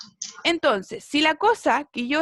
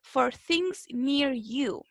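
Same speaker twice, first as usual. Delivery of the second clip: slow, careful read-through; teacher reading a rule slowly; slow reading aloud; much slower than usual. Shown as a demonstration. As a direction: read it out word by word for the class. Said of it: "vamos entonces a leer".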